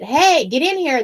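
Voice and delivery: calling out loud and slow for her husband; hollering. Retold or imitated. Wife calling her husband into the kitchen, loud holler. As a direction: holler for him to come quick. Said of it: "So I hollered at him".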